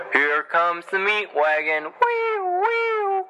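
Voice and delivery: gleeful shout, wee wee soaring very high; a wild falsetto wee wee like a kid on a ride; Chris Farley's Tommy Boy. Retold or imitated. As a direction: ham up Tommy Boy's meat-wagon cheer. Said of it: "That was my impression of Chris Farley's Tommy Boy".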